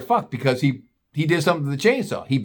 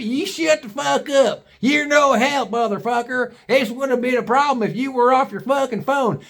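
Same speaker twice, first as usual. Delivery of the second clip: mock angry father; 9 semitones higher than usual. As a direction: bark at the useless son. Pappy yells back at son.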